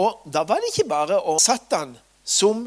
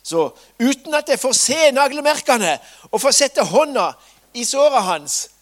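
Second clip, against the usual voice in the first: loud, higher, insistent demand; Thomas insisting; 8 semitones higher, 9 dB louder than usual. Acted. Doubting Thomas refusing to believe without the wounds, loud. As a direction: demand proof, firmly. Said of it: "han sa bare at".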